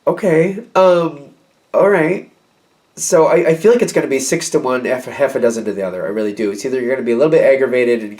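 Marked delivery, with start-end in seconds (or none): 0.1-2.3 hesitant, higher-pitched acceptance; blankly baffled, going along with it. Acted. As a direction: stall blankly, unsure what to say. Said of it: "this blank look on your face".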